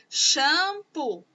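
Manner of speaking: "Shampoo" said in two clear parts: sham-poo; showing the two sounds. Shown as a demonstration. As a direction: set the two syllables apart. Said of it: "dois sons".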